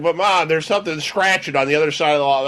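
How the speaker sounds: acting out a pestering child; pleading child. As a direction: plead with mom in a rushed whine. Child pleading with mom in story.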